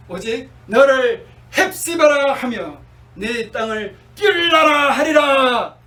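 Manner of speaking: slow, loud, building to a shout; proclaiming shout. Acted. Preacher proclaiming God's promise to the congregation, slow rising shout. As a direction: ring out each word of the promise.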